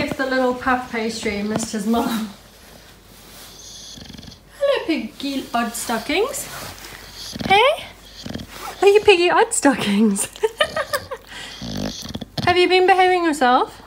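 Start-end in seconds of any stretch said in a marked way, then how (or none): 4.6-13.8 sing-song high greeting to a pet, higher; high cooing pet greeting. Real, not acted. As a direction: coo at the pig in a high voice. Greeting pig after work.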